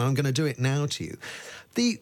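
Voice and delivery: usual speaking voice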